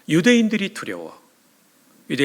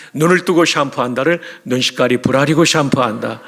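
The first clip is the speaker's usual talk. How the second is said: 눈시까리 부라리고 in loud Pyongyang dialect; lightly voiced Pyongyang dialect, louder; Pyongyang accent; 4 dB louder than usual. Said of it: "평양 사투리로".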